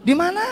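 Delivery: high rising Di mana?; asking tune on mana, loud and high; questioning intonation. Shown as a demonstration. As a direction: really ask it, rising on mana. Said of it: "Kayak agak nanya dong sedikit loh".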